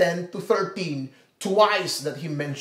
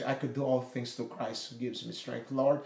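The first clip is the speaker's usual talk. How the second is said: quiet prayer; 6 semitones lower, 10 dB quieter than usual. Pretend. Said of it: "you look at your marriage".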